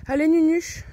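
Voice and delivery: appel aigu et fort, très câlin; voix aiguë affectueuse. Real, not acted. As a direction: interpeller la poule comme un bébé. Calls her hen. (voix de gâtifiage aiguë et forte)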